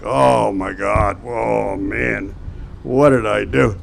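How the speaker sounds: slow, subdued mock despair; understated, retold dread; mild dismay. Retold dismay at losing job.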